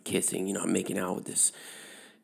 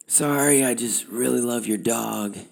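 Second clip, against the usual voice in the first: high, raised call to a stranger; 5 semitones higher, 3 dB louder than usual. Retold or imitated. Cyclist calling to a driver about his dog, calling out. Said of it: "call out to the driver".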